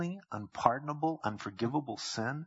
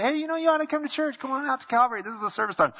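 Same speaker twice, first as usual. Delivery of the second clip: higher, bright friendly invite; inviting a neighbor; 12 semitones higher, 5 dB louder, faster than usual. Retold or imitated. Pastor inviting someone to church, high and friendly. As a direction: cheerfully invite them to church.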